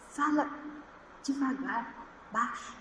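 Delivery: put-on very soft, halting child's plea; gentle hushing. Small boy coaxes a nervous mother, hushed and gentle. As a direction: voice a little boy quietly urging calm.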